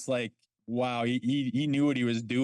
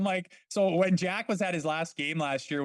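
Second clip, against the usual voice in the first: usual speaking voice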